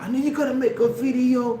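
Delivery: mocking accusation. Mimicking heckler's accusation.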